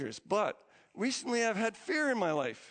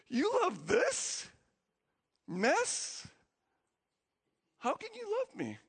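much higher, slow, stunned asking; overwhelmed disbelief; 13 semitones higher, slower than usual. Retold or imitated. Pastor overwhelmed by God's love, stunned high disbelief. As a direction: ask it in tearful astonishment. Overwhelmed, bawling after hearing God.

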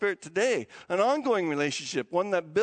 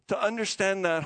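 ordinary delivery

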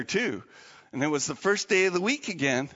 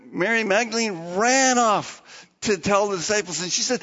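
ordinary delivery

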